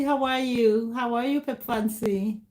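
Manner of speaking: ordinary delivery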